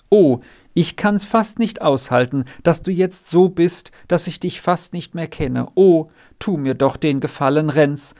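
plain reading voice

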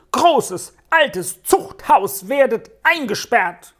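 put-on high-pitched, indignant boy's tirade. A narrator's reading. Peppino renouncing Neo and his robber pack, high angry tirade. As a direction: rail at him in high-voiced outrage. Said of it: "erhob Peppino seine Stimme, die vor Zorn zitterte".

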